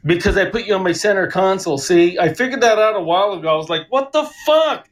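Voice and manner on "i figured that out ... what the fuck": ordinary delivery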